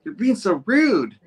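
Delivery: high, slow mock scold. Gwen scolding Harry at the dinner table, high slow mock reproach. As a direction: scold him like an embarrassed girlfriend. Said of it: "Gwen's kind of all pissed off".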